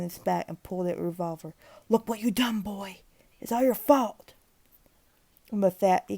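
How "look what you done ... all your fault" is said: raised, hollow blaming voice; high broken old man's accusation; bitter old man. A narrator's reading. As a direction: hand him the guilt. Old man blaming before suicide.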